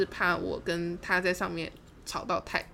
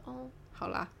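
soft, higher, small voice; meek compliance; 6 semitones higher, 9 dB quieter than usual. Retold or imitated. Speaker yielding to the friend's scolding, meek. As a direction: give in sheepishly, softly.

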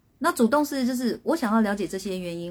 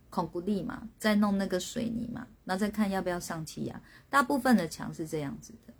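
ordinary talk

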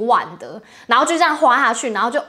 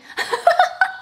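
a short airy laugh rising high; high breathy giggle. Real, not acted. Host embarrassed about bra shopping, giggling. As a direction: let an unvoiced giggle escape.

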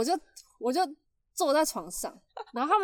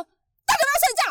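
much higher, louder angry shout; furious yell; 8 dB louder, faster than usual. Retold or imitated. Big sister yelling at cousins to go to bed, furious shout. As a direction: bellow it like you've finally lost patience. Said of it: "我气死了".

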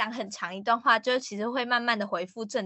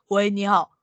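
louder, deep-set phone hello; lowered voice; 4 semitones lower, 6 dB louder than usual. Shown as a demonstration. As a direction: press the voice down to sound manly. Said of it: "打电话过去的时候压低声音".

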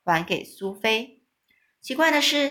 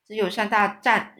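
plain reading voice

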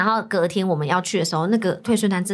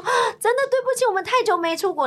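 mock pleading apology; 11 semitones higher, faster than usual. Retelling their apology to customs.

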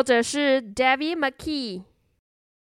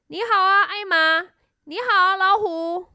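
lion character; 5 semitones higher than usual. A narrator's reading. Lion greets Elmer and tiger with news, higher lion voice. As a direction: play a lion greeting friends.

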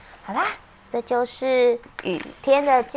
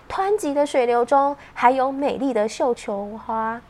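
usual speaking voice

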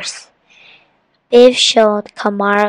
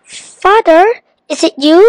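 high, loud questioning shout; 6 semitones higher, 4 dB louder than usual. A narrator's reading. Kamar spots his long-lost father running up, shouting. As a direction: call out loudly, rising in question. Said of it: "he shouted".

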